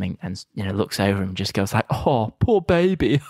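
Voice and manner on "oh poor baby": slow, high, taunting coo; mocking pity. Arnie taunting the fallen Sinbad, slow and high. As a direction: coo it like a mock sympathy.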